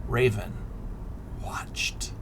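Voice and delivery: usual speaking voice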